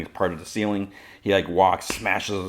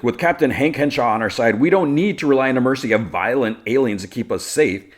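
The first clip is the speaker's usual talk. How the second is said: higher, louder rallying speech; public speechmaker; 6 semitones higher, 5 dB louder than usual. Retold or imitated. Lex promising safety to the crowd, rallying. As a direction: sell it to the crowd, imitating a politician.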